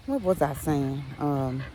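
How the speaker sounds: ordinary delivery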